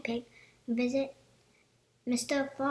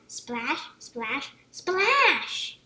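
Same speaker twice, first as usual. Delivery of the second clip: gleeful splash; 7 dB louder, much slower than usual. A narrator's reading. George splashing the toy in the bath, loud gleeful cry. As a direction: shout it as water flies.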